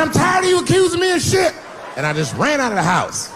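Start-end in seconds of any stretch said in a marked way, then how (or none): 0.0-1.5 very high squealing outburst; indignant shout. Retold or imitated. Husband shrieking back at his accusing wife. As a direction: yelp it in high-pitched outrage. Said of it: "I said".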